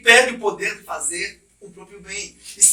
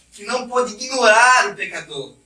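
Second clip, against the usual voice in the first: ordinary talk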